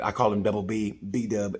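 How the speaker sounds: ordinary delivery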